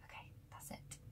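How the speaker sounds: soft whispered sign-off; quietly ending the video. Real, not acted. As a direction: whisper the wrap-up. Signing off.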